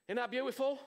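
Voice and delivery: ordinary delivery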